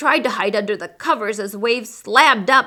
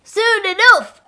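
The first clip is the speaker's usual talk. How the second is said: high, loud, slow refrain; much higher crew chant; crew echo; 12 semitones higher, 7 dB louder, slower than usual. Acted. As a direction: echo the captain together. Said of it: "the crew repeated".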